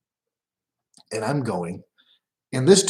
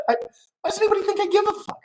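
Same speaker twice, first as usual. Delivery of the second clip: high, fast, laughing squeal; mock high squeak; much faster than usual. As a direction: squeak it out in a silly falsetto while laughing. Laughing mock of his attitude.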